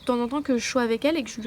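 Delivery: ordinary delivery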